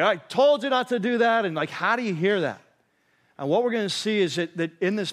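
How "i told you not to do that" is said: higher, louder mock scolding; scolding parent. Preacher voicing God as an angry parent scolding kids, higher and louder. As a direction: snap it like a fed-up parent with the belt. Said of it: "The belt's coming off".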